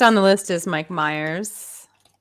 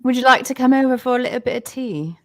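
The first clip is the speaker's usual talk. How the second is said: higher-pitched put-on British accent; Mary Poppins British accent; 6 semitones higher, 3 dB louder than usual. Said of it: "Do your Mary Poppins British accent".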